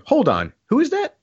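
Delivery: mock astonished double take; imitated surprise. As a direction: snap your head round and ask it high. [name] whips head around.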